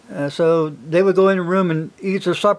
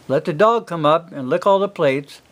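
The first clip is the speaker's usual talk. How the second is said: normal talking voice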